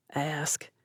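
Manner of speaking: soft tense whisper. A narrator's reading. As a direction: breathe it barely audible. Quiet exchange during a raid.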